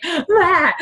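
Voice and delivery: high, strained frustrated "ah"; frustrated cry. Retold or imitated. Coach reliving her panic, a frustrated cry. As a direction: let out a short stressed cry. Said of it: "I'm, like, freaking out".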